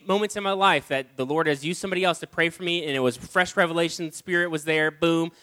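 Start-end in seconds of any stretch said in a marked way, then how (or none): none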